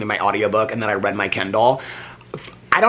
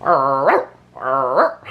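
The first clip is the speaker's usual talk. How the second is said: dog bark imitation; much slower than usual. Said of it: "he's like".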